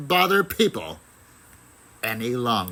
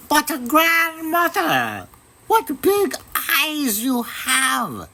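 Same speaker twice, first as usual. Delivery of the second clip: put-on much higher, sweet girl's voice; little girl voice; 14 semitones higher, slower than usual. A narrator's reading. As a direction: sound like a small innocent girl. Girl character voice.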